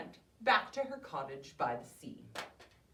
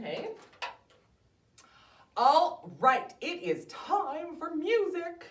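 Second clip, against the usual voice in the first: usual speaking voice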